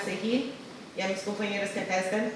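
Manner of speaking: normal talking voice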